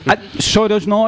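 put-on drawn-out comic Welsh accent. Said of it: "That accent".

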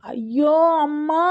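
long, high cry of pain, drawn out slowly; scream of pain. A narrator's reading. Pregnant woman fallen on the road cries out, high drawn-out pained cry. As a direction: cry out as the pain shoots through her. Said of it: "Eswari அலறி துடித்தாள்".

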